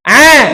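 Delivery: Ah! keras dari tenggorokan tengah; huruf ain dari tenggorokan tengah. Shown as a demonstration. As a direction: ucapkan Ah! dari tenggorokan, nyaring. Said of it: "ucapkan dari tenggorokan tengah". (Ah! lantang dari tenggorokan tengah)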